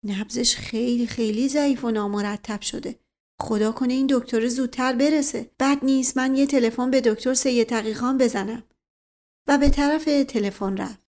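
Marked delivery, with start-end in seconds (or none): none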